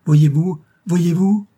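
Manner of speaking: loud, high, insistent question; urgent cry. A narrator's reading. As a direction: press her frantically to look. Maid pointing out a fire.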